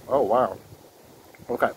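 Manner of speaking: chewing while talking; speaking between bites; mouth full of cracker. Real, not acted. Seen 2: Bit cracker at -5; closed-lip chewing visible through about 7s.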